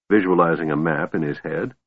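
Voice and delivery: plain reading voice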